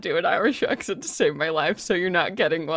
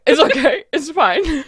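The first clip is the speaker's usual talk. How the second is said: nervous reassurance; 8 semitones higher, 5 dB louder than usual. Acted.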